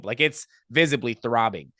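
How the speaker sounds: ordinary talk, nothing marked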